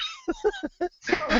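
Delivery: high, breathless laugh burst; helpless high-pitched giggle; squealing high laugh. Real, not acted. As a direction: shriek with laughter. Laughing at the story.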